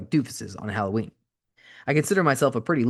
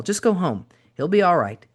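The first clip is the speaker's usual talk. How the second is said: West Texas drawl; 7 semitones higher, 4 dB louder than usual. A narrator's reading. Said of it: "in her West Texas drawl".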